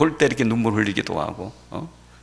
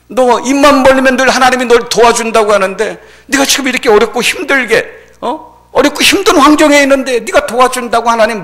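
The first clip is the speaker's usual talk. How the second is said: high, raised jeering voice; mocking taunts; 11 semitones higher, 7 dB louder than usual. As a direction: pile on scornful questions as a bystander. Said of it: "이렇게 조롱을 하는 거예요". (높은 목소리로 조롱하듯)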